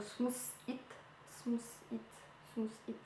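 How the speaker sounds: quiet, tentative English in a French accent; unsure French-accented attempts at English, kept soft; pitiful English accent. Real, not acted. Unable to speak English, reading a product name, hesitant French accent. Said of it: "Mon accent anglais est pitoyable".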